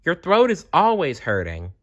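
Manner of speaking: put-on high, chiding little-girl voice; lightly voiced girlfriend; scolding friend. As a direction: tease her for always being sick. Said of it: "Annie said".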